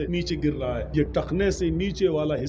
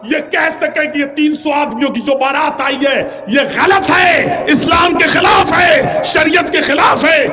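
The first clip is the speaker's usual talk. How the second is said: shouted, much higher sermon climb; fiery preaching; 10 semitones higher, 9 dB louder than usual. Acted. Preacher to the congregation, shouting against wasteful weddings.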